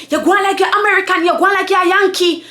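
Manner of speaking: high, fast patois accusation; mocking coworker's quick patois; Jamaican patois. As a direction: imitate her taunt in Jamaican patois. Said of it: "I'ma say this in patois".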